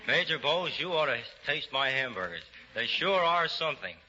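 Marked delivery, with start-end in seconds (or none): none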